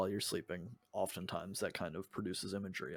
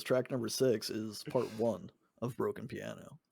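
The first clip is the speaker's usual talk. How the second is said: ordinary delivery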